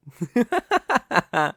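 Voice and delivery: high, short contemptuous laugh; scoffing laugh. Acted. Villain laughing at unfair terms, high and scornful. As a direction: laugh off the insulting offer.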